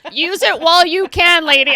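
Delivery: loud, high mock voice of the pushy friend; friend's bossy voice. Retelling her friend's cheeky advice, loud and high. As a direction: ham up the friend's brash advice. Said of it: "she's like".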